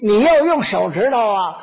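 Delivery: ordinary talk, nothing marked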